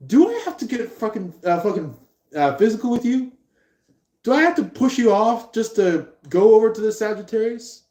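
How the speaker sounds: high-pitched, hammed-up challenge; mock exasperated threat. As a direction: act fed up with a clingy suitor. Acted threat in lovers' quarrel.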